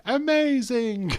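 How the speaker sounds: high, slow mocking Amazing; Miz impression. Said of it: "When has Miz ever said".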